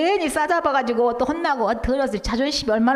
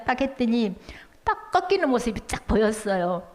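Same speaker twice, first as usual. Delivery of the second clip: ordinary talk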